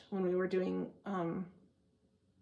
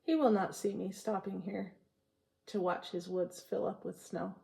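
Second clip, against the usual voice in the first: poem reading. Acted. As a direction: savour each line of the poem. Reads Frost poem to viewers.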